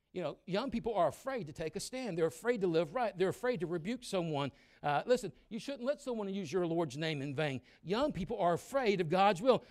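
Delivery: loud, high preacher's shout; full-force sermon voice; fiery preaching. Acted. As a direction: preach it hard to the young people.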